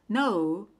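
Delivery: ordinary talk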